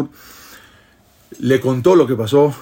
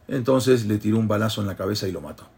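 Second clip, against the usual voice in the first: ordinary talk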